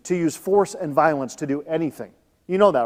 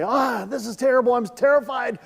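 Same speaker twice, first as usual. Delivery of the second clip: mock terrified; 6 semitones higher than usual. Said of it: "our normal response is to say".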